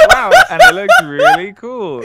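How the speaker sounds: high, loud laughing wow; laughing amazement. Real, not acted. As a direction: gasp out through laughter.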